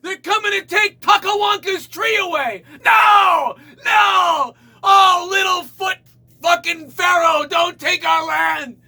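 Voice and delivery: very high shouted panic. Retold or imitated. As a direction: cry out in hammed-up alarm. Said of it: "You just think to yourself".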